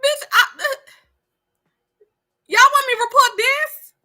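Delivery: high, loud disbelief; incredulous shout. Real, not acted. Mother asking viewers in loud disbelief. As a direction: yell it out in disbelief.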